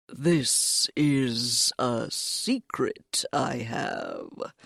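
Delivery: slow, low, secretive cat; sly cat voice. Acted. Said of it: "the cat said at last".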